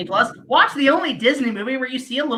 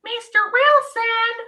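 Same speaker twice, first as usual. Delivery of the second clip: mock Dennis the Menace call; 10 semitones higher, slower than usual. Mock calling name.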